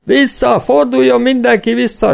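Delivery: high, loud, panicked cry; shouted warning. A narrator's reading. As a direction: yell for everyone to turn back. Wounded rider shouts warning in panic.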